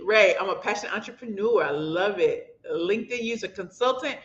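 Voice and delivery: ordinary talk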